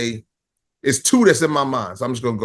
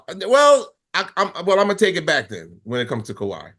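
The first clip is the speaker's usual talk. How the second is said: ordinary talk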